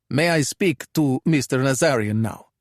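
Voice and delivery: put-on Italian-accented English; pronounced Italian accent. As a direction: speak like a Calabrian thug trying his English. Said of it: "he asked in his pitch-perfect Italian accented English".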